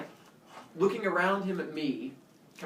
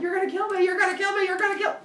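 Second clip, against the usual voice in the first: high, loud, repeated panic; hysterical terror; 5 dB louder, faster than usual. Retold or imitated. Kid caught by the man, wailing in terror. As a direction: wail it like a terrified child.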